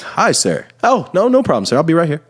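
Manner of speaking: high, bright polite cop-stop voice; sweet overly polite helper voice; customer service voice. Shown as a demonstration. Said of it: "the smile and the customer service voice".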